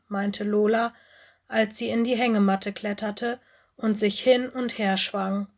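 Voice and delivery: ordinary delivery, nothing marked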